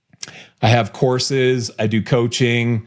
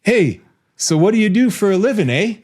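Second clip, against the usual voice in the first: higher-pitched mock Canadian small talk; put-on Canadian friendliness; 8 semitones higher than usual. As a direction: imitate a chatty Canadian stranger. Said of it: "Brad says to me".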